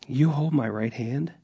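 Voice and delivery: ordinary talk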